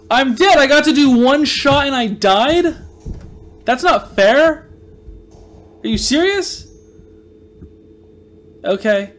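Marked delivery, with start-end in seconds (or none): 0.1-6.6 higher, louder protest at dying; real gamer outrage after dying; real frustrated outcry. Real, not acted. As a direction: yelp it out in real outrage, slowing at fair. Dies in game, protests.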